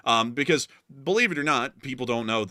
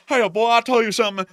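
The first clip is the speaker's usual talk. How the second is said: put-on thick Southern accent; 12 semitones higher, 9 dB louder than usual. Host mimics Southerners chatting before a phone call, thick Southern accent. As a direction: imitate folks hollering in a thick Southern accent. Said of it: "they'll be sitting there talking like".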